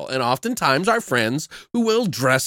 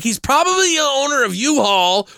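high-pitched, incredulous rant; worked-up comic protest; exasperated rebuttal; 10 semitones higher than usual. Retold or imitated. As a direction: argue in mock outrage, voice pitched way up.

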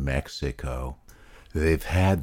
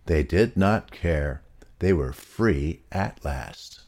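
ordinary delivery, nothing marked

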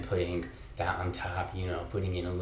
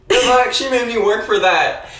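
indignant; 14 semitones higher, 13 dB louder than usual. Real, not acted. Indignant rant about locked door.

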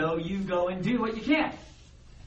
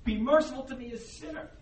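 crying out; 7 semitones higher, faster than usual. Acted. Humbled tax collector pounding his chest, pleading cry. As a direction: beg mercy, dropping away on sinner. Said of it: "is crying out".

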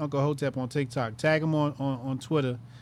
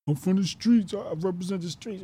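higher mocking put-on voice; mock street tough; 7 semitones higher than usual. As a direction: parody the poser bragging about the streets. Mocking a self-styled street guy.

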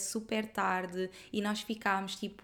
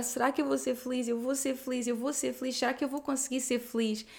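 lightly voiced tearful asking, slightly higher; retold tearful doubt; 3 semitones higher than usual. [name] reliving her tearful doubt, asking mildly pleading. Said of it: "Eu lembro de estar a chorar".

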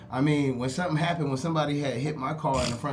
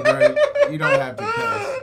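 far higher and louder, mock-pleading with a crying edge; whiny mock plea; 9 dB louder than usual. Mocking his own plea to the cussing driver, whiny high voice. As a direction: beg like a hurt child, hammed up.

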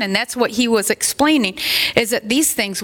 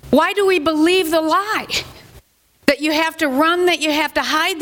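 impassioned preaching; 6 semitones higher than usual. Acted. Pastor pressing his church about hiding sin, impassioned preaching. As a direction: lift it up and press the question home like a preacher.

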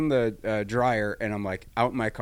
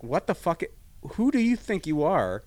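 higher, mildly indignant outburst; mock outraged challenge; retold rage; 6 semitones higher than usual. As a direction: re-enact affronted rage, lightly. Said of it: "I felt this rage".

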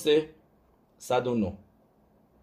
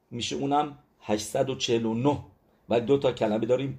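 normal talking voice

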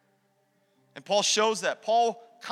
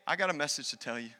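softer quote; 7 semitones lower, 10 dB quieter, faster than usual. Acted. Church voiced quietly.

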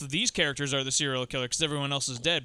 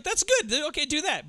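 mock approval; 10 semitones higher than usual. Acts out his imagined approving reaction.

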